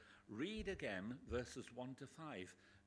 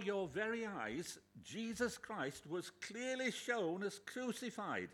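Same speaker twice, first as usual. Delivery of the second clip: loud, high, slow denunciation; angry rebuke; 7 semitones higher, 7 dB louder than usual. Shown as a demonstration. Paul rebuking the bewitched Galatians, loud and high. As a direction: hammer each phrase at the hoodwinked church. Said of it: "There's a way to start it".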